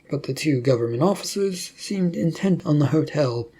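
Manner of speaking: ordinary delivery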